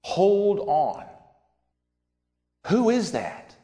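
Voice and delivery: sudden high alarmed question; a young boy catching something unbelievable; acting out David's startled high voice. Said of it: "And he's like".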